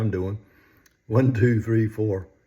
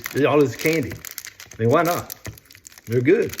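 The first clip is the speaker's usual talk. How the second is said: ordinary delivery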